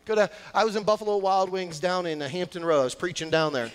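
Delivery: normal talking voice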